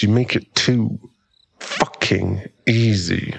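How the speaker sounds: ordinary talk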